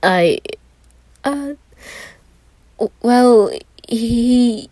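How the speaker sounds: high, panicked halting words; flustered stammer. A narrator's reading. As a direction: stumble for an excuse, cornered. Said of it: "Roman struggled to come up with something".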